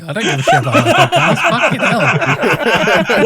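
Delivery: laughing. Acted. Mock refusal, laughing.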